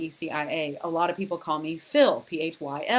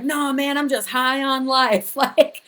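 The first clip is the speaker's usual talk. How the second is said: high, loud, slow giddy insistence; 6 semitones higher, 6 dB louder, slower than usual. Retold or imitated. Answering her dad's text, giddy and dismissive. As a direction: brush off the worry breezily. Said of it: "And I was like".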